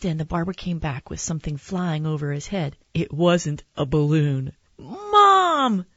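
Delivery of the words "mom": put-on high, loud child's yell; excited yell. Boy to his mom on seeing a dinosaur, yelling high and loud. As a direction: yell up high for your mother. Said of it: "I yelled".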